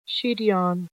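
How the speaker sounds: plain reading voice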